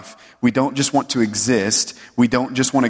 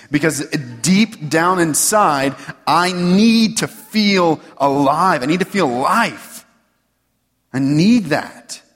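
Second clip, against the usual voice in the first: higher, louder, slow and pleading; slow, higher and louder as the sermon peaks; impassioned longing; 7 semitones higher, 3 dB louder, slower than usual. Acted. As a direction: preach the hunger to feel alive. Voicing inner longing, preaching.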